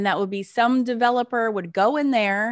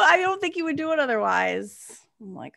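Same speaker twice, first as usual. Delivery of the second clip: ordinary delivery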